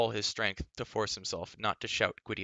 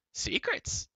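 croaking crow. A narrator's reading. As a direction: squawk it like a crow. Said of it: "Kaw croaked".

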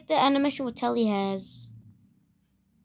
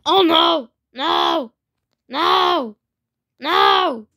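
panicked yelling; 4 semitones higher, 9 dB louder, much slower than usual. Real, not acted. Losing the fight, yelling.

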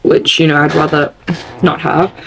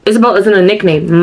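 usual speaking voice

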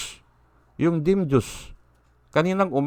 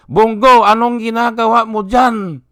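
mocking call-out; 5 semitones higher, 6 dB louder than usual. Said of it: "ito nang sinasabi nila eh".

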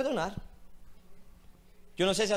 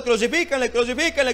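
mock crowd shout; 2 semitones higher, 6 dB louder than usual.

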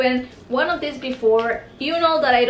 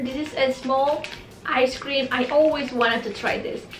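usual speaking voice

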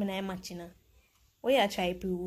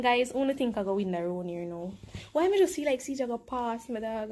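ordinary talk, nothing marked

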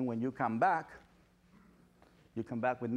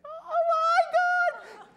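shrieky falsetto squeal, loud and drawn out; squealing mock fan, very high; excited dog lover; 7 dB louder, slower than usual. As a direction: beg across a distance in hammed-up excitement. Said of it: "the person gets excited".

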